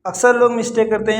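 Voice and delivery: usual speaking voice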